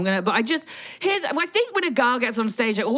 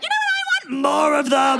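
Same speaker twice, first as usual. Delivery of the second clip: high squeaky eager quote, pushing up to a shout; hammed-up greedy want; mock eager; 6 semitones higher, 3 dB louder than usual. Joking about a man wanting more, eager mock shout.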